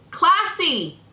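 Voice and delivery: high mock exclamation, falling off at the end; sarcastic "classy". Real, not acted. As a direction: toss off "Classy!" with sarcastic disbelief. Sarcastic reaction to crude DM.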